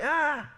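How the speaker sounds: high little mock cry-out; imitated baby cry. Uncle imitating his one-year-old nephew suddenly crying out, high mock cry. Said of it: "suddenly, he cries out".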